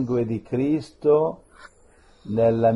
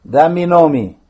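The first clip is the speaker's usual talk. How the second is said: loud, demanding questions; stern command; 5 semitones higher, 9 dB louder than usual. Real, not acted. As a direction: interrogate the spirits. Priest commanding the spirits.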